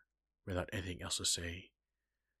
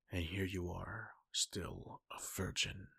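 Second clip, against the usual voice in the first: usual speaking voice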